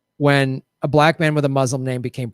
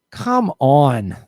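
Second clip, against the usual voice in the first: exasperated; 3 dB louder than usual. Real, not acted.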